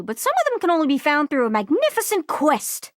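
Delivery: normal talking voice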